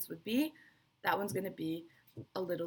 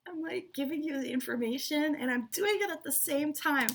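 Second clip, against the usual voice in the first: higher, bubbly aside; excited; 7 semitones higher than usual. Real, not acted. Teacher tells students she's excited, giggly and high. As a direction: gush about doing it live. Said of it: "So excited".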